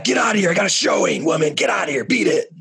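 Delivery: hammed-up exasperated ordering away; mock angry shooing. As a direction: jokingly yell to chase someone off. Mock-chasing a clingy mother away.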